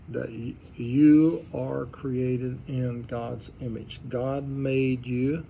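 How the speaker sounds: each word slowed and weighed; slow emphatic stress. Shown as a demonstration. Teacher slowing down so the class hears it. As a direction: drive it home slowly, word by word. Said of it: "They emphasized it again".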